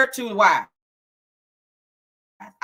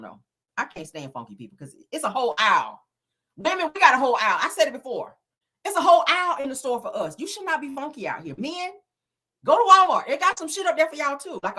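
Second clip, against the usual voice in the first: normal talking voice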